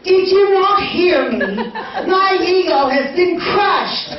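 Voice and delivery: high-pitched, mock-wounded protest; indignant retort. Patient to dentist about a younger match, indignant. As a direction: play up the bruised ego.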